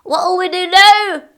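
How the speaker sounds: high, loud panicked cry; pirate yell. Acted. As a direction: yell it in the storm. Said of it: "yelled one of the pirates".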